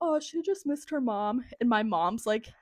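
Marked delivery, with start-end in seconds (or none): 0.0-1.4 higher, lightly voiced cooing assistant; a hint of pitying coo; sympathetic assistant. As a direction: explain someone's tears with mock sympathy. Assistant explaining tears to her mother.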